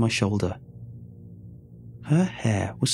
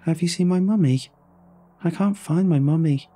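put-on small, high child's voice repeating itself; a lost girl saying it again and again; little girl voice; 8 semitones higher than usual. A narrator's reading. Lost girl repeats her question to the rescuer, high child voice. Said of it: "all she said were the same things over and over".

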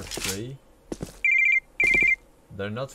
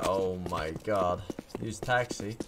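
ordinary talk

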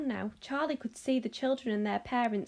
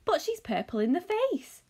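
cried out; 8 semitones higher, 3 dB louder than usual. Acted. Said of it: "cried Grandpa Joe".

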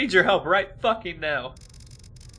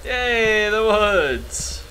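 mock delighted, higher and louder; sarcastic cheer; 7 semitones higher, 4 dB louder, much slower than usual. Real, not acted. Player sarcastically cheering at the woods, mock delight. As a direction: fake joy at the spooky woods.

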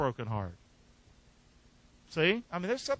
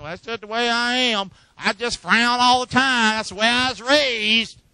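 mock excuse maker; 4 semitones higher, 8 dB louder than usual. Mocking an excuse maker's words.

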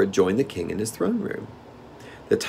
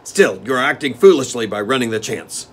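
rough; 4 semitones higher, 8 dB louder than usual. Acted. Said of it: "continued the steward roughly".

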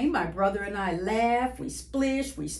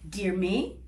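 plain reading voice

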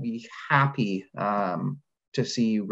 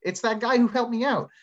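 much higher-pitched pleased "aha" moment; excited recognition; 11 semitones higher than usual. Acted. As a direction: act out happily spotting a familiar name. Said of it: "I have this moment of".

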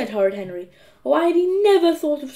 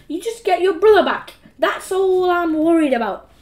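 higher, louder cross mum; screeched insistence; 6 semitones higher, 5 dB louder than usual. A narrator's reading. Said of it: "screeched Mum".

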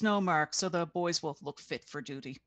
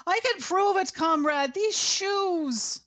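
shrill, much higher and louder desperate cry; screeching protest; 12 semitones higher, 8 dB louder, slower than usual. A narrator's reading. Yelena protests to the arresting officer, high frantic screech. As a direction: screech it out, pleading your loyalty. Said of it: "Yelena screeched her protest".

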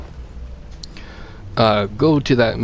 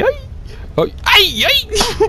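startled squeal; slower than usual. Real, not acted. Startled by monster in game.

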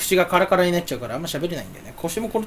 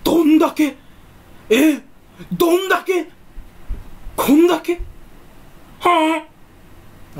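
very high, loud campy "どんだけ？"; mock "どんだけ" catchphrase; 10 semitones higher, 4 dB louder than usual. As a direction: shriek the gag in a flamboyant voice. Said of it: "どんだけって言おう". (高く大きい声のオネエ風「どんだけ？」)

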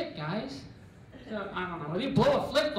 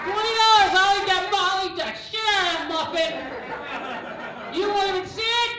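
much higher, much louder eager pitch; auctioneer kid; 9 semitones higher, 5 dB louder than usual. Retold or imitated. Kid in the back hyping his bid, shrill and loud. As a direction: sell it hard, way up high. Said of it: "like an auctioneer".

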